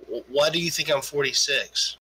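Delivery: ordinary delivery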